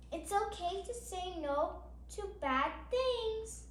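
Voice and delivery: steady, gentle picture-book reading; reading to children. Acted. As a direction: read each page out to little listeners. Reads picture book aloud.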